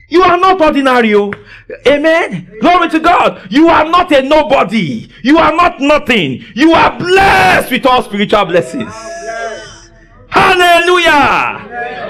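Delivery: high, fervent preacher's cry; full-force preaching. Real, not acted. Preacher assuring the church they are blessed, fervent high proclamation. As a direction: build to a long, shouted hallelujah.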